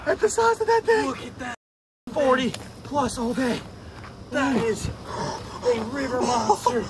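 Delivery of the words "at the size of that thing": high-pitched amazed cry; amazed exclamation. Real, not acted. Angler showing off the huge fish, high amazed cry. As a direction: exclaim high in real astonishment.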